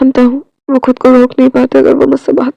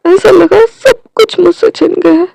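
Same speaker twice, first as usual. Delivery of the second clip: tearful; 5 semitones higher than usual. Acted. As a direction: voice breaking into tears, slow. Tearful confession of loss.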